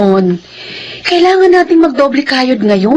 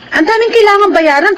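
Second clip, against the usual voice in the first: exasperated complaint; 8 semitones higher than usual. Acted. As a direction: vent about all the bills. Wife complaining about bills. (asawang naiinis sa dami ng bayarin, mataas at malakas)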